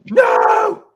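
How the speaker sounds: put-on high, loud wailing child's cry; panicked crying shout. Son panicking about police arrest, crying shout. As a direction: wail it like a terrified five-year-old. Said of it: "he goes".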